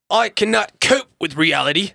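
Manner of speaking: put-on high-pitched German accent slipping into British; hammed-up German-turned-British accent; botched German accent. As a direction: attempt German, land on British. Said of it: "I guess the German turned British real quick".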